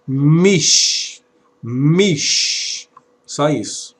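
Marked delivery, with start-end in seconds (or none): 0.1-2.9 "Mish" drawn out on a long final sh; syllable demonstration. Shown as a demonstration. Teacher showing students how the first syllable is pronounced, "Mish" held slowly. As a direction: sound out "Mish" as one closed syllable. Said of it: "a pronúncia dessa palavra".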